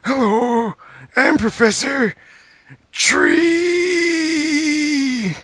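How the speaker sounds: very high, slow silly professor with a long drawn "Tree". Acted. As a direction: stretch out "Tree" in a squeaky cartoon voice. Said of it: "the second time he's like".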